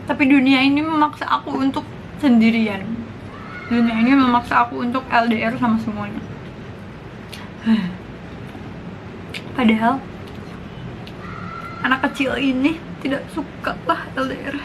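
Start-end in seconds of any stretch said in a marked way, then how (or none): 6.2-9.5 sigh. Real, not acted. Sighs about being forced alone.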